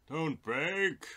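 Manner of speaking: loud, high pleading cry; high shout. Acted. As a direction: beg the computer loudly. Pleading with the game loading.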